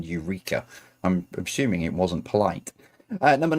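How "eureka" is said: soft, deadpan "Eureka."; flat quiet Eureka. Retold or imitated. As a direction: throw away "Eureka." quietly, under your breath. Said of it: "running around the streets naked going".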